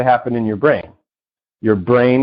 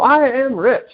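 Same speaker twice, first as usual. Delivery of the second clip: crowd echoing; 11 semitones higher, slower than usual. Retold or imitated. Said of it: "And they go".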